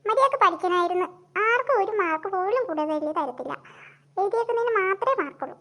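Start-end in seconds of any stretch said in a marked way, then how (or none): none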